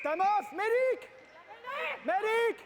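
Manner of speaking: loud call for the medic; man down shout. Real, not acted. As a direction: shout urgently for medical help. Referee calls medic for injury.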